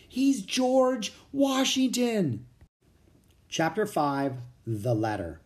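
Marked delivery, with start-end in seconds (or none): 0.1-2.4 higher, slow, awed reveal; amazed exclaim. A narrator's reading. Jack telling Annie who the rider is, amazed. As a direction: let the name land in wonder. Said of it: "he exclaimed".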